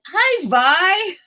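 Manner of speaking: bright lifted hello to a friend in chat; excited greeting. Real, not acted.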